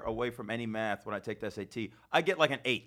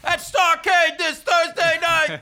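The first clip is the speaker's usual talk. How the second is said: high, loud, slow wrestling villain shout; heroic rallying shout; 13 semitones higher, 10 dB louder, slower than usual. Acted. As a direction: roar the threat like a cage-match heel. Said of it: "the villain who's gonna be in the cage".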